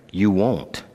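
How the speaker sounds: ordinary delivery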